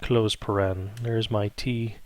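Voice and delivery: usual speaking voice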